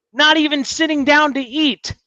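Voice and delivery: high-pitched disbelief at normal volume; incredulous. Real, not acted. Incredulous retelling, first take.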